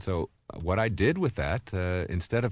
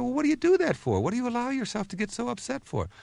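much higher, incredulous question to the waiter; exasperated high quote. Retold or imitated. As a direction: squeak out disbelief at his temper. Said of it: "I said".

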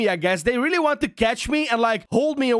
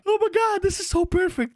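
ordinary talk

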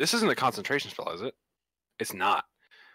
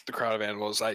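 ordinary talk

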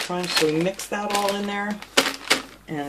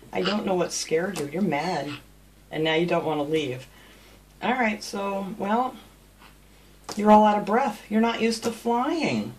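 usual speaking voice

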